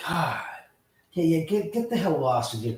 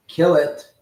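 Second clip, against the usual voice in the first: louder repeated command; loud order; 4 semitones higher, 7 dB louder than usual. Real, not acted. Streamer repeats the order to his helper, loud and firm. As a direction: bark it out the second time.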